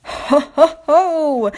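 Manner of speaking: hearty mock chuckle. A narrator's reading. Duck laughing at Diesel's mishap, hearty chuckle. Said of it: "chuckled Duck".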